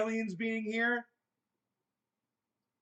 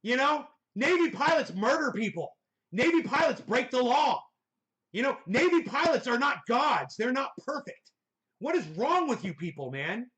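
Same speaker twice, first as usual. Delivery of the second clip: loud, higher-pitched, exasperated outburst; angry rant; 5 semitones higher, 4 dB louder than usual. Real, not acted. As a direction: fire back at the claim, raised voice. Angry retort to the played clip.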